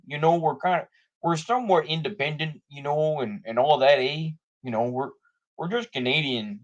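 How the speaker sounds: put-on Anglo-Canadian accent. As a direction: play a caricature Canadian with a thick accent. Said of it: "that's my Anglo-Canadian accent".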